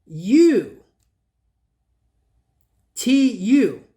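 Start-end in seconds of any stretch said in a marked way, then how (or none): 0.1-0.8 U as a long oo, louder; stretched oo vowel; U sound. Shown as a demonstration. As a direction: hold a loud long oo. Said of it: "the U sound".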